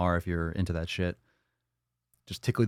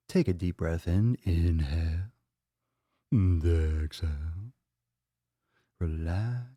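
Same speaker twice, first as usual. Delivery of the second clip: slow calm breathing cues; guided meditation; 3 semitones lower, slower than usual. Acted. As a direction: ease them into the breath. Leads breathing exercise.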